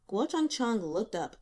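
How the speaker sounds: usual speaking voice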